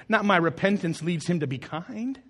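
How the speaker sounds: ordinary talk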